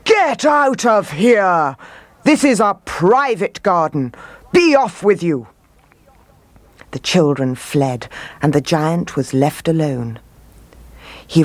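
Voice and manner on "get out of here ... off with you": angry shout. A narrator's reading. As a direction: shout it in a rage to chase children off. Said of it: "he shouted".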